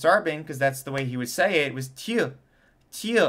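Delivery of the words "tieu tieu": high, falling "Tieu" with a Y glide; French pronunciation. Shown as a demonstration. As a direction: say "Tieu" with a Y vowel, not oo. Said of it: "the pronunciation of the word two".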